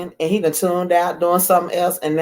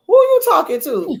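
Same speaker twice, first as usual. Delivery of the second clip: high, laughing mock indignation from husband; husband asks who she's talking to, high and laughing; husband's mock outrage; 14 semitones higher than usual. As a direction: squeak it out, half laughing, offended. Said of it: "He was like".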